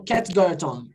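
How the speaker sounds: quick connected delivery; fluent speech. Shown as a demonstration. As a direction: link "cat got your tongue" into one fluent phrase. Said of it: "quando eu falo assim fluentemente".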